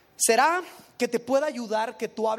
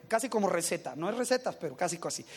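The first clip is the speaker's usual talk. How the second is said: usual speaking voice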